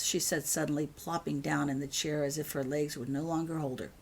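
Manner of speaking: ordinary delivery, nothing marked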